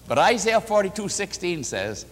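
ordinary talk

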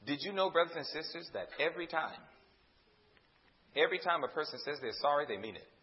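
soft, low, confiding to the congregation; quiet and low, sinking at the end; hushed confiding. Real, not acted. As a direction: drop to a hush to make the point.

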